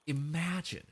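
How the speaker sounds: ordinary talk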